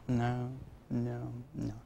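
low, soft, flat refusal; a dad who won't even look up; stern dad refusing. Retold or imitated. Dad brushing off his son, low and flat.

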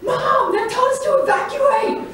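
put-on high, loud panicked shout of the kids. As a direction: yell it up high and loud like a scared kid on the phone. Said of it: "my kids are like".

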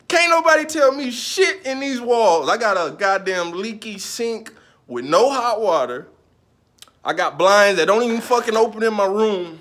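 loud, high, fed-up complaining; venting at full volume about the broken blinds; exasperated rant. Real, not acted. To viewers about his apartment, loud exasperated ranting.

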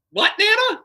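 incredulous. Retold or imitated. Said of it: "You're like".

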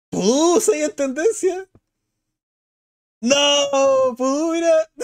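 high, squealed exclamations; amazed delight. Real, not acted. Streamer seeing Pudú sixth in trends, amazed delight. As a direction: cry out in delighted disbelief.

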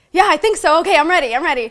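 high, loud, jittery yes; nervous excitement. Retold or imitated. Retelling to the interviewer how she nervously agreed to hit post. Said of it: "And I'm like".

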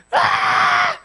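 put-on very high, loud toddler shriek; imitated child's scream. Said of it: "And she was screaming".

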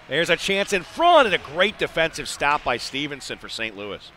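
excited play-by-play. Real, not acted. Announcer calling a scoring chance for the home team, excited. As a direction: call the rush with mounting urgency.

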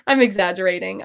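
usual speaking voice

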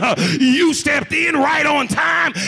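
loud testimony with rhythmic punctuating breaths; full-force preaching. Real, not acted. Preacher praising God for blessings, fervent full-force preaching. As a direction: drive each phrase home to the congregation.

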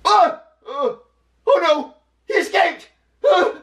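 very high, loud shrieks; panicked kid character. Acted. Junior finds his pet rat gone, panicking.

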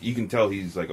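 usual speaking voice

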